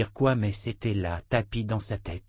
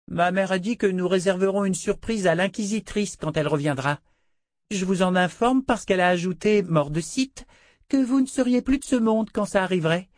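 put-on high, haughty little-girl voice; haughty child princess; 8 semitones higher than usual. A narrator's reading. Princess haughtily threatening Dena, high child voice. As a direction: lord it over her like a spoiled child.

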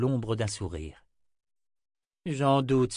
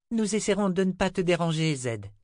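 put-on high-pitched child's voice; high, sincere girlish voice; little girl Rachel, earnest; 8 semitones higher than usual. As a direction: speak as a small girl making a promise, pitched up.

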